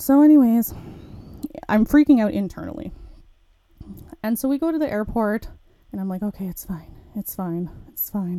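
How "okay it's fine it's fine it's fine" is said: quieter, slightly lowered retold self-talk; muted self-reassurance. Student telling herself it's fine at the airport, muted self-reassurance. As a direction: soothe yourself under your breath.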